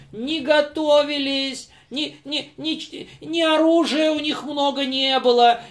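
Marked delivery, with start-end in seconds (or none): none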